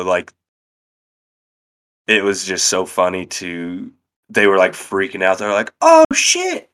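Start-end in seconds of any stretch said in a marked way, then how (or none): 5.8-6.7 high and loud, amazed at snow; excited. Retold or imitated. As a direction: squeal it like you've never seen snow. Imitating amazed Florida kids seeing snow.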